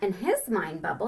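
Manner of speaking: plain reading voice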